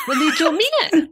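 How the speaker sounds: laughing through the words; giggling as she speaks. Real, not acted.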